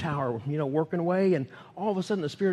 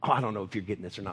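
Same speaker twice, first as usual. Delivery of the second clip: normal talking voice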